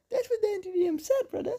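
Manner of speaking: normal talking voice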